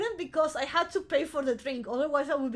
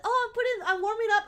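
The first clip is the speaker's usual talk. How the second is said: high, louder mock waitress excuse; high, hammed-up innocent waitress; 10 semitones higher, 4 dB louder, faster than usual. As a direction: squeak the excuse like a caught waitress.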